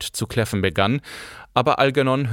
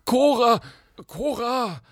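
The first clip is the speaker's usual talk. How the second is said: much higher calls, the second fading as from afar; calling after her; 14 semitones higher, slower than usual. A narrator's reading. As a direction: call down the hallway after his wife. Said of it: "hörten sie ihn durch den Gang rufen". (Rufen durch den Gang)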